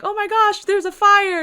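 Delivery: high, loud panicked cry; panicked shout. Shown as a demonstration. Reader showing how the panicking woman should shout. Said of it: "like, shouting".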